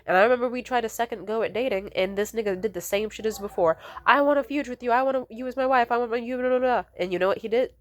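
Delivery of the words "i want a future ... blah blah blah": high, dismissive imitation of an ex; mocking empty promises. Ex promising a future on second try, high mocking imitation. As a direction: rattle off love promises in mockery.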